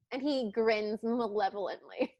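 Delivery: normal talking voice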